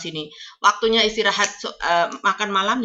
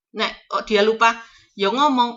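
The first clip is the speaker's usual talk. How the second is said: ordinary talk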